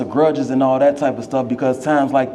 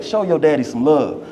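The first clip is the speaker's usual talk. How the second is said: ordinary delivery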